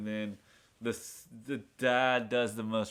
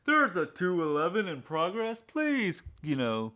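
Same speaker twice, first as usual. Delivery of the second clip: mock police dispatcher; 5 semitones higher, 4 dB louder than usual. Police radio call retold.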